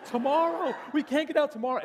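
high, loud mock panic; exaggerated high-pitched protest; panicked octave jump. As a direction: imitate a fretter's voice leaping up in alarm. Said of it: "his voice goes up a whole octave".

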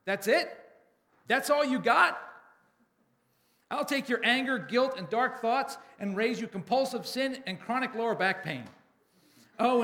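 loud, high-pitched challenge; boisterous one-upping shout; booming voice. A narrator's reading. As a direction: call out from the back of the room. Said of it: "a voice booms from the back of the room".

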